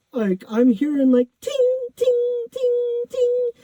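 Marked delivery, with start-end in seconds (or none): 1.4-3.5 very high-pitched metallic tings; imitated BB ricochets. Storyteller mimics pellets bouncing off pool bars, high ringing tings. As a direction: ring out each ping in a high, sustained voice. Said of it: "That's the little B Bs bouncing off".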